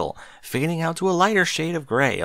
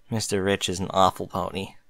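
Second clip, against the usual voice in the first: offhand Bucky voice; 5 semitones lower, 4 dB quieter than usual. Acted. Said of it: "said Bucky off-hoofedly".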